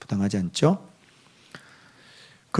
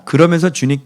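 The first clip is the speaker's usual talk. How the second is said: ordinary talk, nothing marked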